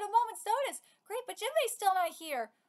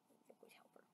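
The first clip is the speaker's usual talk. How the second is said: tender whisper to a pet; whispered praise; 35 dB quieter, faster than usual. Real, not acted. Owner whispering to Kitty, tender. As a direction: breathe it softly to the cat.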